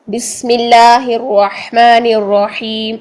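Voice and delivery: slow, held recitation of بسم اللہ الرحمن الرحیم with hissing s; recited seen example. Shown as a demonstration. Teacher reciting to show seen, بسم slow with thin s. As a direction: stretch the vowels, whistle the s of بسم. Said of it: "بسم اللہ میں دیکھ لیجئے".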